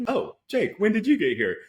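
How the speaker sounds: high-pitched mock surprise; cartoon character imitation. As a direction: imitate a cartoon character suddenly noticing a friend. Quoting cartoon character after his scream.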